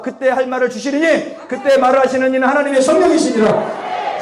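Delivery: high, driving revival declaration; full-force preaching. Real, not acted. Preacher proclaims healing and Spirit-given words to congregation, full force.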